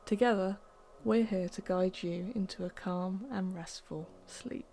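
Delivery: plain reading voice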